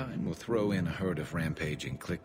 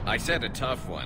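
raised, higher teasing demand; playful taunt; 8 semitones higher, 4 dB louder than usual. Acted. Garrus teasing Shepard mid contest, higher playful taunt. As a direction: push him for a harder target.

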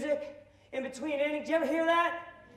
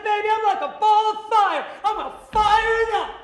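higher, louder showman brag; hyped self-pitch; 8 semitones higher, 11 dB louder than usual. Retold or imitated. Vendor hyping himself to the entertainment consultant, loud and brash. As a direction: sell yourself like a ball of fire.

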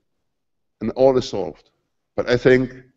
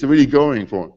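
ordinary talk

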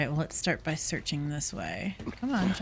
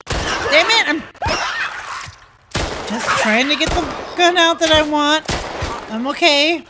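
breathless, high, loud panic; gasping out words in panic; hyperventilating; 8 semitones higher, 9 dB louder than usual. Real, not acted. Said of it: "Sorry, I'm panicking".

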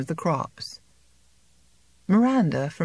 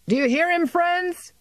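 much higher, loud rallying cry; shouting; 13 semitones higher, 3 dB louder than usual. A narrator's reading. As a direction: call out to the crowd of farmers. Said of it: "he shouted".

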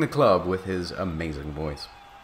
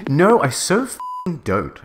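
higher, drawn-out indignant protest; shouted denial; 8 semitones higher, 4 dB louder, slower than usual. Acted. Ebony denying her crush to a friend, mild shout. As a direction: deny it hotly, stretching the words. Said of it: "I shouted".

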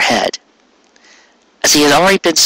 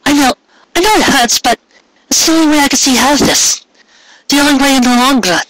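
put-on young mare, gentle; 14 semitones higher than usual. A narrator's reading. Mare consoles Carrot.

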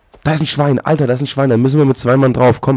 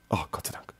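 whispered relief; 7 semitones lower, 15 dB quieter than usual. Real, not acted. Relief after scare.

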